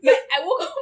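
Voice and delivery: laughing through the story of crying. Real, not acted. Telling friends how she cried, laughing.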